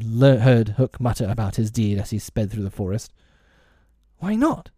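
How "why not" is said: put-on high boyish query; puzzled question. A narrator's reading. Peter asking the fairy why not, high puzzled question. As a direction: ask it well up in pitch.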